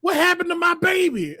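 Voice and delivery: high-pitched mock yell; retold yelling. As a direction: cry out in imitated alarm, pitch jumping up. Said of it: "And I'm yelling".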